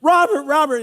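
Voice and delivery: high, loud panicked calling of "Robert"; screaming a name. Retold or imitated. Father on the beach yelling for his missing son, panicked. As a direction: call out for the lost son in panic. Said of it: "I'm screaming".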